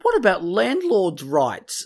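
high, loud, whining complaint; whiny landlord. Retold or imitated. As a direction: whine it like an entitled landlord. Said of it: "They're like".

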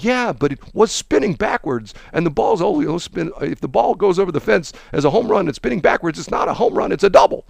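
high, heated mock argument; lightly voiced coach ranting; other coach arguing. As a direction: argue a made-up rule. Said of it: "the other coach comes out".

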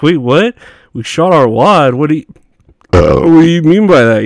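baffled what. Retold or imitated. Coworkers mocking manager's odd phrase.

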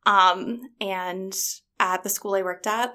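usual speaking voice

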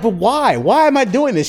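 high, loud mock whine; hammed-up, pleading why; retold exasperation. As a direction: protest in comic bewilderment. Retells his exasperated protest.

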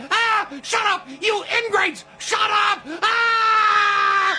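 high, loud shouted rage; furious yell. Retold or imitated. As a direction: scream at them in fury. Al yells at family.

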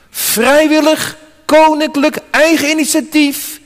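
lifted, ringing declaration; triumphant preaching. Real, not acted. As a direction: declare each word like a royal title.

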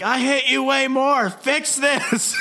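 very high, strained mock yell; comic exasperated screech; mock furious comeback. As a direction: outdo your rival in hammed-up rage. Body-swapped rivals bickering, yelling.

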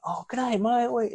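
high-pitched, overdone Aussie greeting; full Aussie. Retold or imitated. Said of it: "they get full Aussie".

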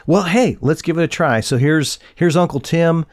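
ordinary talk, nothing marked

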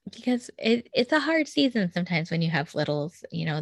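ordinary talk, nothing marked

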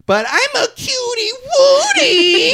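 hammed-up, slow, shrieky little-girl voice. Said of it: "[name] is like".

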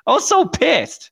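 high, drawn-out complaint; worked-up exasperation. Real, not acted. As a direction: let the voice soar in exasperation, slowly. Recalling anger at being insulted.

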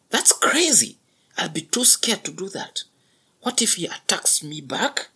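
high, breathy frightened whisper; startled young buffalo. A narrator's reading. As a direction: gasp it out in panic. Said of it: "said the startled young buffalo".

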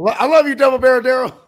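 excited cheer. Real, not acted. Cheering for his player.

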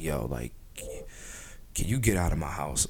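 low, hushed irritated request; quiet annoyance. Retold or imitated. Host acting out annoyance at a guest, low and quiet. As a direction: drop low and soft, acting fed up.

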